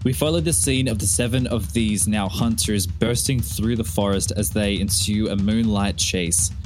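usual speaking voice

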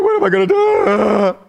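loud, high panicked wail; panicked freak-out. Acted. Preacher acts out stressing out, panicked wail. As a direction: cry it out like it's all falling apart.

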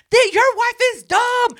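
high, loud exasperated announcement; mock hollering. Telling the husband about his wife, in a high, loud mock holler. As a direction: holler it as a retold complaint.

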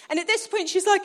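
usual speaking voice

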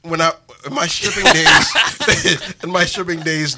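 slow words through real laughter; laughing. Real, not acted. Host admitting his past, laughing.

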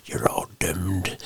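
slow, creepy whispered doom warning; ominous whisper. Retold or imitated. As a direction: hush it like a spooky prophecy. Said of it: "he's just like".

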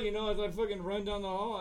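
normal talking voice

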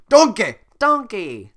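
high, loud cartoon yell; loud cartoon call; cartoon character call. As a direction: shout the name like a cartoon. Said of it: "sounded like a cartoon character".

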